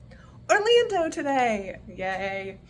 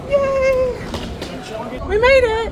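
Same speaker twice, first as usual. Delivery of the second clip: high, loud happy cheer; excited cheer; 11 semitones higher, 4 dB louder, much slower than usual. Real, not acted. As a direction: celebrate making it aboard. Boarding the ship, celebrating.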